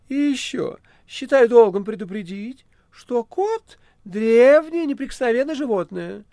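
high, loud, sulky cat voice; a grumpy cat defending himself. Acted. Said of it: "проговорил кот".

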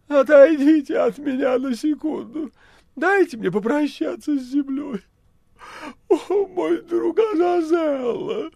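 high, loud, theatrical dying moan. Acted. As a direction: ham up a tragic farewell. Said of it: "простонал кот".

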